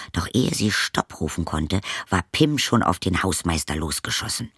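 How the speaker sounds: ordinary delivery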